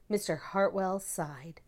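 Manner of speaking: ordinary delivery, nothing marked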